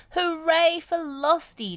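high, loud, drawn-out cheer. A narrator's reading. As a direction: give a long hooray. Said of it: "cheered Wendy".

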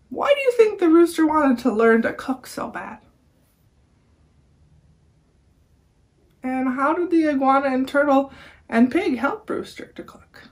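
put-on talking to children. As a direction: ask the kids like a friendly teacher.

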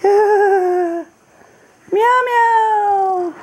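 high, drawn-out kitty meow; cat meow imitation; high imitated meow. Meowing at the cat.

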